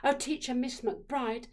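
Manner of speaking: ordinary delivery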